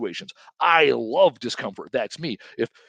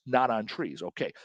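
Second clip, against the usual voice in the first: usual speaking voice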